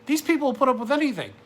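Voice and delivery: high, loud, rising fury; God's angry rant. Retold or imitated. God denouncing cowardly pastors, high and loud in anger. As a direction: preach it as an outraged God. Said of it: "he's just like".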